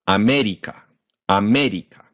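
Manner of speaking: "America" as ah-MEH-dee-kah, tapped r; pure Japanese vowels in "America", English stress kept; Japanified pronunciation. Shown as a demonstration. Teacher swapping in Japanese sounds, "America" as ah-MEH-dee-kah. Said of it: "with Japanese ones, and we kept those vowels perfectly consistent".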